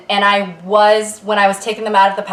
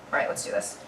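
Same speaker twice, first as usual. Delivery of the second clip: whisper; 13 semitones lower, 14 dB quieter, faster than usual. Acted. Whispered to self.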